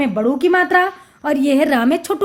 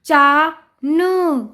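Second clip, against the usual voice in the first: चा न drawn out, each sound separate; much slower than usual. Shown as a demonstration. Teacher sounding out a word for children, syllable by syllable. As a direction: stretch चा, pause, then न. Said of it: "उच्चारण करके पढ़ लिया".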